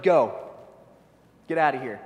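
loud dismissive command; Pharaoh ordering them out. Acted. Preacher voicing Pharaoh throwing out the Israelites, loud command. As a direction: bark them out of Egypt. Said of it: "Pharaoh's like".

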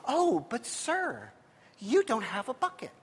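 slow, softly mannered mock politeness; pious churchy talk. Imitating the church version of the woman at the well, pious and polite. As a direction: imitate a meek, reverent woman, drawn out.

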